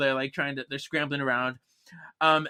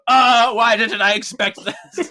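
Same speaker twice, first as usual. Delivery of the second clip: laughing villain; 9 semitones higher, 5 dB louder, slower than usual. Acted. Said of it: "He's like".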